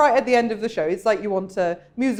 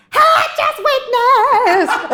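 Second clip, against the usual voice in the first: mock diva line; 10 dB louder, much slower than usual. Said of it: "then goes".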